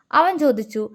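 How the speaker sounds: ordinary delivery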